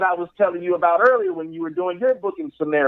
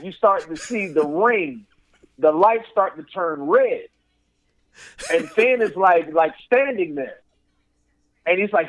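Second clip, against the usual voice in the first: plain reading voice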